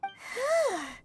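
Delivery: long falling sigh. Acted. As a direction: let out an audible sigh. Game companion reacts to bold statement.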